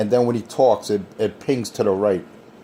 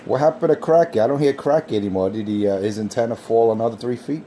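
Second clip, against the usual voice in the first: ordinary delivery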